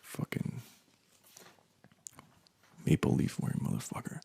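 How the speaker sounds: whispered, seething grumble; muttered insult. Acted. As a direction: curse them under your breath. Muttered insult at the border officer.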